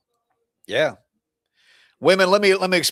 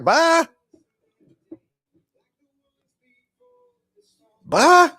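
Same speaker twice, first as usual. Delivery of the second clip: high sing-song bye; breezy goodbye; 10 semitones higher, 4 dB louder than usual. Retold or imitated. Mocking a departing partner with a high sing-song bye. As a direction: wave them off lightly in a high sing-song. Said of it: "Now it's like".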